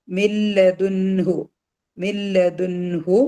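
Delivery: മില്ലദുൻഹു slowly, with a distinct n before hu; clear n sound. Shown as a demonstration. Teacher shows a clear noon, മില്ലദുൻഹു recited slowly with crisp 'n'. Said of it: "നൂനിന്റെ സൗണ്ടിനെ clear ആയിട്ടാണ്".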